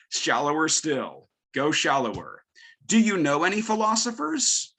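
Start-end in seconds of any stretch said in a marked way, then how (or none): 2.8-4.7 high-pitched question to a class; teacher quizzing students. Retold or imitated. Teacher fishing for anything students know, high questioning voice. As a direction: pitch it up, as if polling weak learners.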